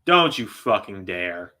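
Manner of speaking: low, slow, menacing growl; slow warning. Real, not acted. Streamer warning the wrestler on screen, slow menace. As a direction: dare him slowly, under threat.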